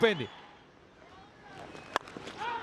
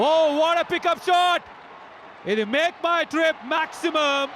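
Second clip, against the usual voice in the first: excited cricket commentary; 5 semitones higher, much slower than usual. Real, not acted. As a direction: stretch out the big six call. Six called live. (ఉత్సాహంగా six వ్యాఖ్యానం)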